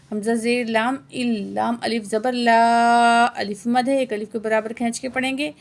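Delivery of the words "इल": usual speaking voice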